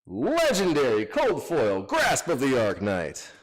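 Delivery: loud hyped-up yelling; excited shout. Real, not acted. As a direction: shout it out over a huge pull. Big pull, hyped shouting.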